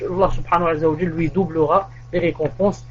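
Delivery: ordinary delivery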